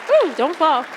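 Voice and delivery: loud, sudden call; startled warning. Real, not acted. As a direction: catch her before she trips. Student nearly trips on stage.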